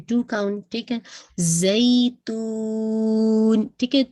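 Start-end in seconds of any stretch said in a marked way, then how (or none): 1.4-3.7 زیتون with the یا held very long; stretched vowel. Shown as a demonstration. Teacher demonstrating the long stretch in زیتون. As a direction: draw out the یا of زیتون slowly, whistling ز. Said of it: "اس کو آپ نے stretch کرنا ہے".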